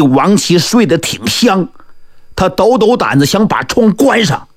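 ordinary delivery, nothing marked

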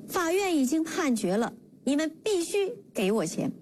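mustering courage, calling out. A narrator's reading. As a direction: insist boldly, without truly shouting. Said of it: "壮着胆子冲公婆喊道". (旁白演绎壮着胆子喊话，音调偏高)

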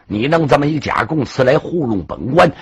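ordinary delivery, nothing marked

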